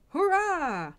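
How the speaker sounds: high, loud hurrah; cheering. A narrator's reading. Crowd greeting the soldier's golden carriage, cheering. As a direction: cheer it out in celebration.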